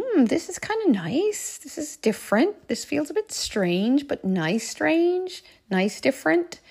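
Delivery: high, drawn-out savouring of something new; pleased musing. Retold or imitated. Mother musing to herself at the airport, pleasantly intrigued. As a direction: muse slowly, lingering with pleasure.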